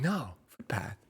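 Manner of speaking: a half-hearted Australian "No, footpath"; mock Australian accent. Retelling Nat's Australian reply, half-hearted accent. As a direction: insist in a weak Australian accent. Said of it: "I can't do the Australia accent".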